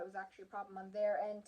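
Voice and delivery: ordinary talk, nothing marked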